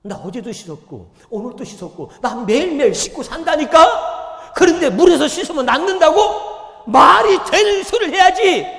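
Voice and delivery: high, loud indignant shout; furious Naaman. Retold or imitated. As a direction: blow up at the absurd advice. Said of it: "그러니까 성질이 난 거야".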